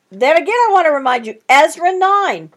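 normal talking voice

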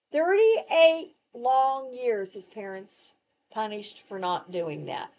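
ordinary delivery

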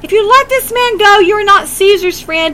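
a crowd shouting threats at Pilate; crying out. Retold or imitated.

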